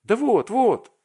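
high, loud, delighted cry; acted burst of delight; thrilled anticipation. A narrator's reading. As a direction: show it off in excited expectation. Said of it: "дрожа от восторга и от ожидания".

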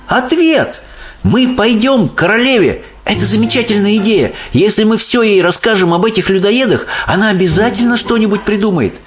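put-on high, eager girl voice; excited. A narrator's reading. Sophie proposes going to the Queen, high and excited. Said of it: "её голос задрожал от волнения".

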